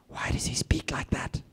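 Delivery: whispered aside during a talk. Retold or imitated. Colleague whispering to me mid-presentation. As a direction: lean over and whisper it.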